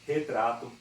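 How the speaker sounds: Retrato called out loud and bright; announcing the word. Shown as a demonstration. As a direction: call out Retrato like a classroom example. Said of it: "tem o T e o R juntos".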